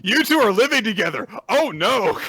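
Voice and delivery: high, loud mock alarm; shocked realization. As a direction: cry out in comic shock. Acted shock at living together.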